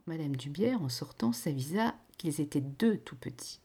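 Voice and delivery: ordinary delivery, nothing marked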